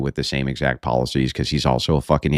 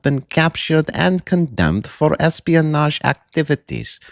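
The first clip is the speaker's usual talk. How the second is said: slow, much higher villain monologue voice; pompous slow villain speech, pitched high; Russian movie villain; 8 semitones higher, slower than usual. Retold or imitated. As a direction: lay out the threat slowly like a Rambo villain. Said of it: "this Russian comes in and he's like".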